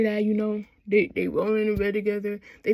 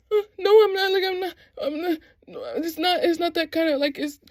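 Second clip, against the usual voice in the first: high, stammering mock protest; flustered denial; 6 semitones higher than usual. Shane denying to Hayden he's getting laid, flustered. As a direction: imitate a flustered man backing out of it.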